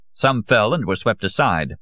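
usual speaking voice

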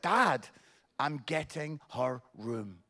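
low, soft, deadpan; unbothered son. Retold or imitated. Son tells dad he gets sister's room, unbothered. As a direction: imitate a son who doesn't care. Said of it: "that's it, doesn't care".

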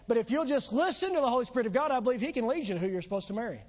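ordinary talk

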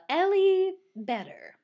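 put-on name said in a slow, broad Midwestern accent. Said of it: "in the most Midwestern accent that I can".

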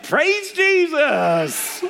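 mock triumphant mother, high and drawn out; mom's exclamation. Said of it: "my mom's like".

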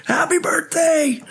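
very high, bright birthday greeting; high excited happy birthday call; cheery birthday shout. Retold or imitated. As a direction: squeal it out like a surprise party. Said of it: "stuck my hand up and went".

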